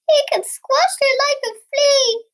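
high, loud squeal; alarmed warning. A narrator's reading. Frightened Cat warns Mummy Frog about the giant, high loud alarm. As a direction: warn her in a high panicky cry.

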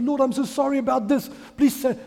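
high, loud imitation of begging; hammed-up, hurried apology to God; mock pleading. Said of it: "we throw our sins in front of God".

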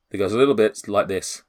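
ordinary delivery

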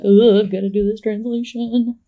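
hammed-up exasperated groan into the line; lightly acted frustration; mock furious grumbling. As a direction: grumble it like an overworked, fed-up scholar. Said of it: "really kind of furiously".

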